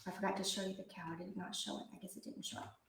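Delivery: soft, fast muttered aside; quiet aside. Real, not acted. Teacher aside to class, quiet and quick. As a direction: mumble it to yourself. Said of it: "teacher aside, quiet".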